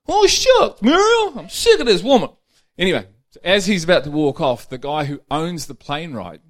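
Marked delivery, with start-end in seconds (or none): 0.1-2.3 high, loud whine of defeat; husband sulking after his wife refuses again; sulking old husband. Acted. Husband sulks in joke.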